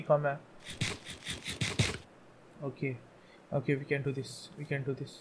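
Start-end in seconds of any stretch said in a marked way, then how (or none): 2.6-5.1 quiet self pep talk. Real, not acted. Self-pep whisper low.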